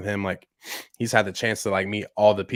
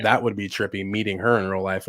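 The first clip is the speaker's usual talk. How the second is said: ordinary talk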